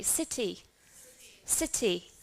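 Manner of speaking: "City" with a clearly sounded T; British T. Shown as a demonstration. Teacher asks class to read after, British T. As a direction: keep the T in "City" a real T. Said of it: "in British English we would pronounce as".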